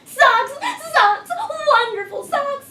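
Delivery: high, loud, gleeful cheer; excited child. Acted. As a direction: cheer it like a happy kid. Tina sings praise of socks.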